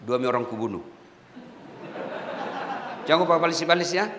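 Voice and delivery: a lightly voiced tough inmate warning the preacher, low and menacing. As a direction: threaten him low and cool.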